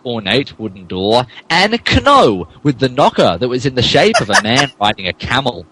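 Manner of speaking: normal talking voice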